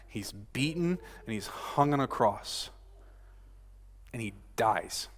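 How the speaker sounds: grave, hushed telling with long pauses; hushed solemnity. Real, not acted. Solemn retelling of the crucifixion.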